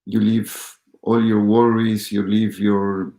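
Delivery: ordinary talk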